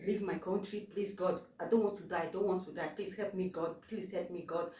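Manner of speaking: soft, pleading prayer under the breath; hushed desperate prayer. Retold or imitated. Retelling a terrified flight, quietly pleading to God. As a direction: beg God quietly, over and over. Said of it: "I started praying to God that".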